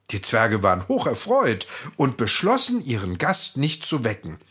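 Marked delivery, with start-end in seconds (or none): none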